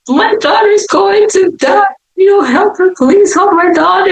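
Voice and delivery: high-pitched mock wailing plea; hammed-up tearful mom; strategic crying. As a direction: beg like a mother crying on purpose. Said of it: "my mom starts crying strategically".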